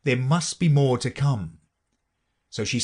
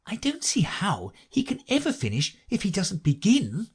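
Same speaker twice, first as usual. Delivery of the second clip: high-pitched Alice thinking to herself; Alice's thought; 9 semitones higher than usual. A narrator's reading. Alice wonders if he will ever begin, high-pitched private thought. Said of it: "Alice thought to herself".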